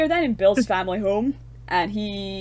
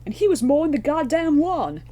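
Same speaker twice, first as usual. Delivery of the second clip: much higher and louder, hammed-up deadpan line; quoting film delivery; 9 semitones higher, 7 dB louder than usual. Quoting the fire guy's line from the film, hammed up high and loud. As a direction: sell the line like the actor did. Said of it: "I thought the delivery was rather nice".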